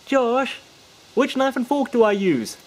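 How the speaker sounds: put-on high, loud child's question; kid asking. A kid at the table asks the magician about cutlery, high and loud. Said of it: "some of them would go".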